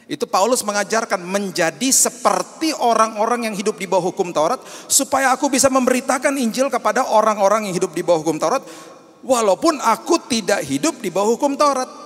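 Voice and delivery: loud, high impassioned preaching; preaching at full force. Acted. Pastor preaching passionately to congregation, loud and high. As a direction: preach it to the rafters.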